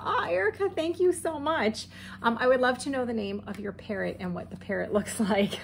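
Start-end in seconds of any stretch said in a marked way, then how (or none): 0.0-1.9 high, sweet thank-you; touched and delighted. Real, not acted. Thanking Erica for the postcard, high and touched. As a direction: gush a thank-you, lifted way up.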